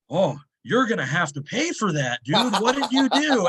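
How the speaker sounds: mock accusing manager. Lifter imitating gym manager blaming him for bar, mock outrage. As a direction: play the indignant gym manager.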